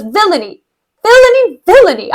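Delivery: dramatic stage indignation; outraged cry. Acted. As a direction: cry out the word, rising in outrage.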